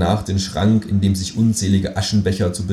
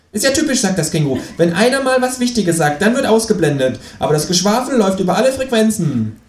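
much higher, pert kangaroo voice; cheeky kangaroo voice; 12 semitones higher than usual. Acted. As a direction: play the kangaroo as a sassy, high-voiced know-it-all. Känguru complaining.

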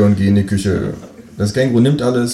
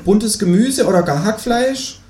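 much higher cheeky kangaroo voice; 13 semitones higher, slower than usual. Acted. Kangaroo asking the narrator for eggs, much higher voice. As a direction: play the new neighbour kangaroo, pitched far up. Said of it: "sagt das Känguru".

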